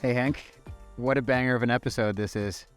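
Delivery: ordinary delivery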